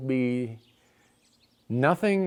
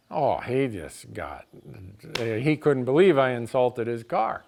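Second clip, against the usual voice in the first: ordinary talk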